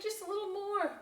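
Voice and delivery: high pleading; pleading kids. Acted. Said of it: "everybody pleaded".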